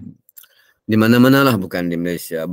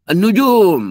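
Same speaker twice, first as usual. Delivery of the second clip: put-on much higher, loud child's answer; 12 semitones higher, 8 dB louder, slower than usual. Child below answering his father, high and loud. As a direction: pipe up like a small child. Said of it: "Dia jawab apa tu?".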